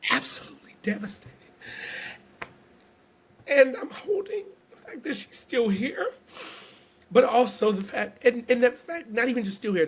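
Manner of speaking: fighting tears, voice catching; emotional. Real, not acted. Speaker grieving her sick cousin, voice cracking with emotion. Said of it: "I don't know why I'm getting emotional".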